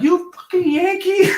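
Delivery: high-pitched imitation of an angry Southern woman; mock pissed-off woman. Angry dispatcher to the Yankee caller, mock pissed-off woman. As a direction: throw out the insult in a high, put-on voice. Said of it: "she got pissed at me".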